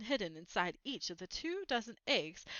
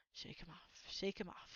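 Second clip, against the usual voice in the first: soft, nearly whispered mutter under breath; 3 semitones lower, 11 dB quieter than usual. Acted. Uncle Vernon driving, muttering to himself. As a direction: mutter it to yourself, fading in. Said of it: "he would mutter".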